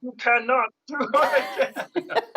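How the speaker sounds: high, slow puppet-creature voice; half-laughing little alien sage; croaky sage puppet. Pretend. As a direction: squeeze it out slowly, high and strained. Another go at the Yoda voice.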